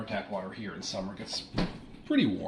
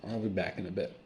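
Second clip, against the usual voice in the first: ordinary talk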